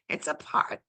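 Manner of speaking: soft secret whisper of the line; whispered party. Real, not acted. Streamer echoing her party line to chat in a whisper. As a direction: hush it like a secret aside.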